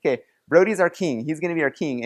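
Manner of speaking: ordinary talk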